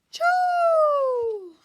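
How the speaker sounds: high, loud sneeze burst; big sneeze. Acted. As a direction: let the sneeze explode out. Acted sneeze.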